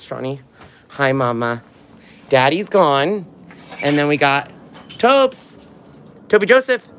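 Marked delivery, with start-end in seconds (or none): 4.9-6.9 excited call to dog. Real, not acted. Calling the dog.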